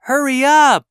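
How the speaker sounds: loud, high impatient yell; impatient shout. Acted. Honking at slow traffic.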